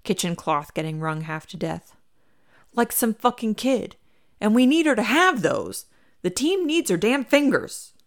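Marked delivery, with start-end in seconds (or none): 2.7-7.9 exasperated, rising rant, higher and louder; venting at the clumsy kid; fed-up head chef griping; huffing rant. Acted. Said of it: "Lupe huffs".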